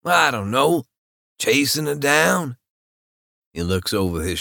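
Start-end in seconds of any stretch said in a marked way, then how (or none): 0.0-2.6 character voice. Acted. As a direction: lift the voice for another man. Male narrator voices a character.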